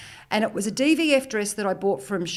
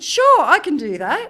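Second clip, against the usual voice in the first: high, loud, drawn-out agreement; retold breezy overconfidence; overeager yes; 6 semitones higher, 7 dB louder than usual. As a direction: say it slowly and far too brightly. Repeats overconfident yes to editor.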